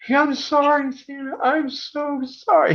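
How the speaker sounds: put-on very high, pleading child's apology; a panicked little boy begging forgiveness; apologetic kid.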